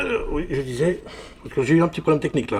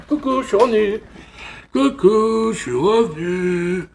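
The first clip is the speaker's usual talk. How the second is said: cheery greeting; 7 semitones higher, 5 dB louder than usual. Real, not acted. Returns to camera after break.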